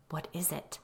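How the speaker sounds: quiet, hushed question; soft worried question; low voice. A narrator's reading. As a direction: keep it low so the child won't hear. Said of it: "I asked in a low voice".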